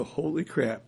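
softly voiced stunned reaction; a touch of hushed disbelief; understated amazement.

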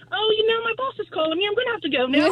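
high, bright put-on English accent; a young American woman's fake English accent. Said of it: "your accent's on point".

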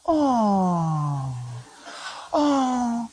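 put-on soft cooing over a baby; hushed doting coo. People stopping to see the baby.

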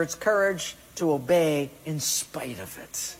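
ordinary delivery

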